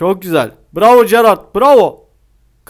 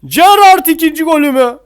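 excited hope; 8 semitones higher, 5 dB louder than usual. Real, not acted. Hoping for second goal.

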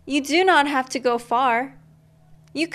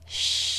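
soft whispered shh; wind shush; 7 dB quieter than usual. A narrator's reading. Reading to a child, the wind whispering a soft shh. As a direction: hush it like wind in leaves. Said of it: "It whispers".

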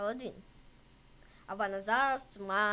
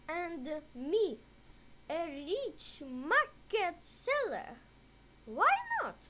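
put-on much higher, wondering boy; boy's voice; 5 semitones higher than usual. A narrator's reading. Aladdin wonders about a shop, higher voiced.

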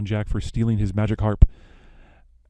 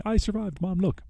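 much higher, a lightly voiced excited kid; mock proud boy; 9 semitones higher, 4 dB quieter than usual. Jack shows his mother the harp, lightly voiced and gleeful. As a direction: chirp it like a kid showing off.

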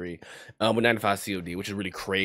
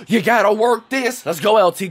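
excited shout; 11 semitones higher, 9 dB louder than usual. Real, not acted. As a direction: yell him on down the field. Cheering his runner.

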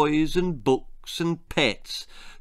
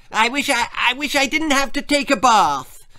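put-on whiny child voice; 9 semitones higher, 4 dB louder than usual. A narrator's reading. Percy's whiny wish voiced.

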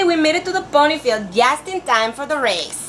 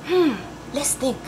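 ordinary delivery, nothing marked

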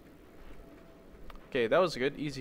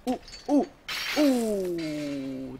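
quick high yelps into a long falling "oh"; yelp, then wail as it goes wrong; high oh-oh-ohhh, sliding down; alarmed ohs; 5 semitones higher, much slower than usual. Real, not acted. Botched swing in the game.

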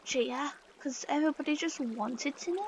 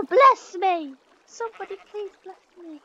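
mock theatrical plea; 5 semitones higher, 12 dB louder than usual. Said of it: "I must have this sneeze on camera".

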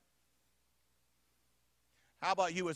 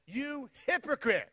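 slow, higher accusing cry; yelling; 7 semitones higher, slower than usual. Retold or imitated. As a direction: call him out in front of everyone. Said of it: "He yells out".

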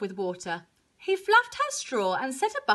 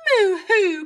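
high, loud moo-hoo; tearful cow wail; crying cow; 6 semitones higher, 6 dB louder than usual. A narrator's reading. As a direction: sob it out as a moo. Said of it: "cried Cow from the back".

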